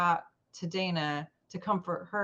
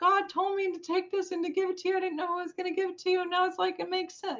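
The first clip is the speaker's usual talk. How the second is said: excited revelation; 12 semitones higher, faster than usual. Retold or imitated. Quoting her realization, voice jumps.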